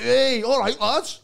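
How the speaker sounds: high, loud hearty hello; loud overbearing greeting. Retold or imitated. Overbearing fella greeting the lads, loud and high. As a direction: boom a matey hello. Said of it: "He's like".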